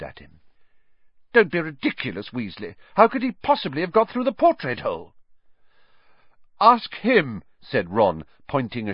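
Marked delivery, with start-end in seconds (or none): 1.3-5.1 sharp, impatient teacher; stern disbelief. Acted. Teacher dismisses student. 6.6-7.4 high, loud urgent demand; insist, still shaken; shaky urgency. Acted. Boy points shaking finger.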